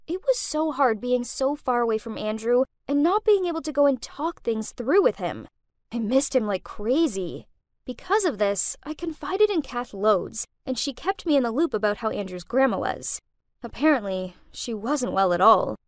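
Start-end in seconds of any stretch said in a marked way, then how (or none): none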